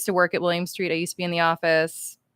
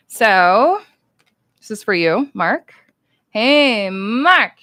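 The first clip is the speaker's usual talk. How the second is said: louder, bright presenting call; 3 semitones higher, 9 dB louder, much slower than usual. Real, not acted. Giving a fan his signed card, bright loud presentation. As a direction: hand it over with a bright call.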